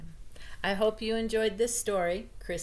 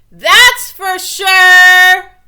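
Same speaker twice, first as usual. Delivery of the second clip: much higher and very loud, very slow; loud defiant declaration; 13 semitones higher, 27 dB louder, much slower than usual. A narrator's reading. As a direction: belt it out, stretched long. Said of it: "she added".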